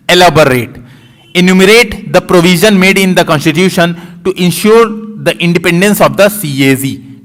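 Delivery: very slow, word by word reading of the exam question; slow read-aloud. Shown as a demonstration. Teacher reads the English question to students, very slowly. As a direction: read it out slowly, like dictating to a class. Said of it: "English में एक बार पढ़ देता हूँ।".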